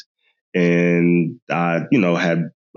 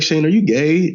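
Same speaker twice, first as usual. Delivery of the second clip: mother asking; 7 semitones higher than usual. Retold or imitated. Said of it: "you were like".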